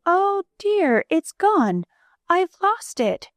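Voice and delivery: aunt discovering her ring is gone, dismayed cry. A narrator's reading. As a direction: cry out as the loss sinks in. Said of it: "she gave a sudden cry".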